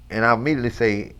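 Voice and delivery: ordinary talk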